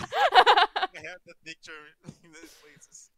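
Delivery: slow, loud, laughing through it. Real, not acted. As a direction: giggle out every word. Recalls cursed cat picture.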